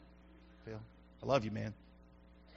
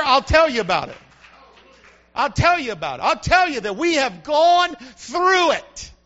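louder, higher preaching to the church; full-force preaching; 6 semitones higher, 6 dB louder than usual. Acted. Pastor preaching hard to his church, full force. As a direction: preach it out loud to the congregation.

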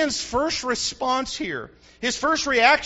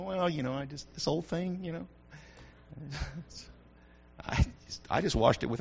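1 bashful; 7 semitones lower, 10 dB quieter than usual. Retold or imitated. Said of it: "you struggle with it".

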